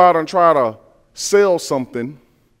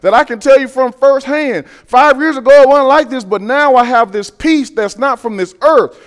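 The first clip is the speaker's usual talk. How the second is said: excited testimony; 6 semitones higher, 6 dB louder than usual. Retold or imitated. Voicing an excited believer's testimony.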